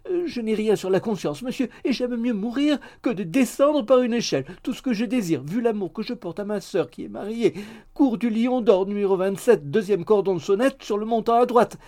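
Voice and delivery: high-pitched maid in a fluster; frightened maid, pleading. A narrator's reading. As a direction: plead melodramatically, high and insistent. (servante affolée, voix aiguë et suppliante)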